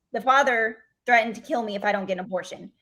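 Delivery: usual speaking voice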